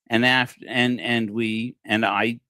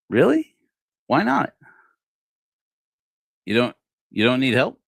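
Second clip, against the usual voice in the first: surprised question; 4 semitones higher, 4 dB louder than usual. Retold or imitated. Retold asking the man.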